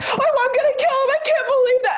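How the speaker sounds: very high, tearful mock wail; mock screaming. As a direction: imitate a friend's hysterical outburst, hammed up. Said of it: "they start screaming".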